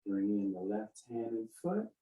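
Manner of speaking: soft, unhurried movement cues; serene tai chi cues. Shown as a demonstration. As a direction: calmly talk them through the form. Leads a tai chi form.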